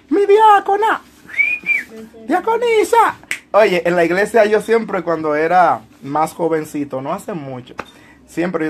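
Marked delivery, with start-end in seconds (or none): 0.1-3.2 mock reverence. Teasing a deaconess commenter.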